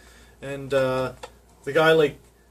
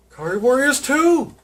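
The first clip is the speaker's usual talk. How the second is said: excited; 10 semitones higher, 6 dB louder than usual. Real, not acted. Excited find in game haul.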